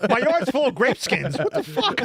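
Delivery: high, exasperated mock complaint; outraged neighbor. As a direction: play an incredulous neighbor finding a mess.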